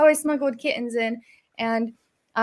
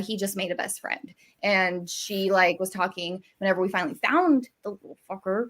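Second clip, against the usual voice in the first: usual speaking voice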